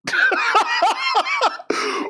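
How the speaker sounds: high, helpless cackle; real laughing fit. Real, not acted. Streamer hearing their old British-sounding video, cracking up. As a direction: burst out in a long high laugh.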